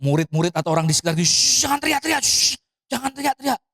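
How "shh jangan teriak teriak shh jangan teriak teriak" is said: high-pitched scolding shush; imitated hushing. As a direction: play a crowd shushing a shouter. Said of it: "Begitu dilarang". (menirukan orang menyuruh diam)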